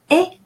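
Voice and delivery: E said as the vowel in "egg"; French "ai" sound, E as "eh"; open E sound. Shown as a demonstration. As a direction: say E as a short open "eh". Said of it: "like in the English word egg".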